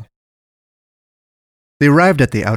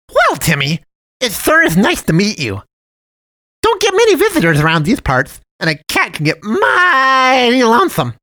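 high, louder cartoon cat voice; sugary high cartoon voice; jolly cat man; 12 semitones higher, 6 dB louder than usual. A narrator's reading. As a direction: play the friendly dump cat, overly sweet. Cat man chats, claims loneliness.

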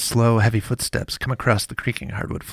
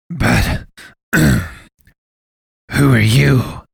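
much louder, very slow demand after a throat clear; 3 semitones higher, 9 dB louder, much slower than usual. A narrator's reading. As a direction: clear the throat, then insist. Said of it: "he demanded".

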